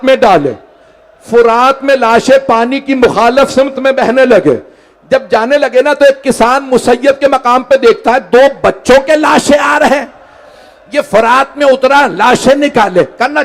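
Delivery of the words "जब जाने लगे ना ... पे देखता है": usual speaking voice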